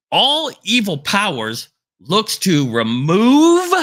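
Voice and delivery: full-force preaching. Acted. Preacher warning listeners that evil replaces the faithful, full-force preaching. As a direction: hammer each phrase like a revival preacher.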